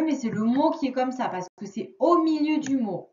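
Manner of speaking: usual speaking voice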